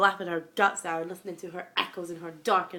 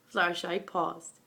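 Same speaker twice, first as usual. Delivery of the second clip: plain reading voice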